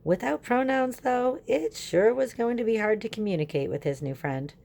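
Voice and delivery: usual speaking voice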